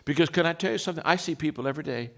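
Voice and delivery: usual speaking voice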